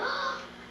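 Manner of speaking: high startled gasp. Acted. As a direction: catch your breath in fright. Discovers mommy missing.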